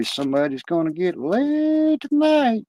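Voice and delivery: high, slow squawky parrot imitation; sing-song it like a cheeky parrot; lightly mocking talking bird. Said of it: "this fucking parrot would say".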